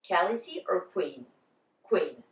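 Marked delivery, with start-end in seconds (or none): none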